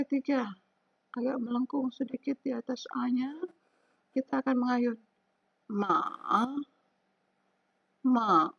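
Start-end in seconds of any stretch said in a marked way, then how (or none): 5.7-8.5 "ma" dipping down then rising, third tone. Shown as a demonstration. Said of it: "sudah turun, naik lagi".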